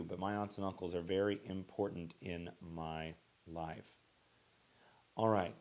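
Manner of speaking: ordinary talk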